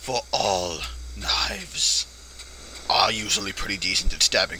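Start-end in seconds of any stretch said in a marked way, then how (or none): none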